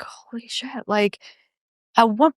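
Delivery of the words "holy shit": breathless whispered exclamation, higher; stunned hushed whisper; retold whisper. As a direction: whisper it in awe. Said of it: "And I was like".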